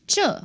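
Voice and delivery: 这 starting high and falling; fourth tone. Shown as a demonstration. Teacher isolates the first word for learners, fourth tone. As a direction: drop sharply through 这 in fourth tone. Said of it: "fourth tone".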